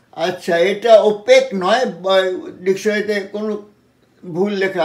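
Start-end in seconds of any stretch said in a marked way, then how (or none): 1.0-1.8 ওপেক with a short plain e; wrong pronunciation. Shown as a demonstration. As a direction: say ওপেক as o-pek, short vowel. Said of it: "উচ্চারণটা হচ্ছে ওপেক".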